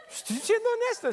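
words squeezed out through high laughter; laughing. Real, not acted. Preacher cracking up while admitting honesty to church, laughing.

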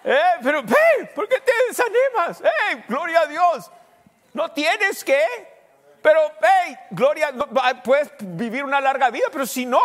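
loud, high fervent preaching; shouted pulpit exhortation to a discouraged listener; preaching at full force. Acted. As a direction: fire up the church like a revival preacher.

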